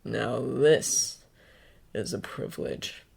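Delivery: low, slick menacing voice; sinister villain voice. A narrator's reading. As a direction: purr it like a killer. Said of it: "Stain's voice is as slick as oil".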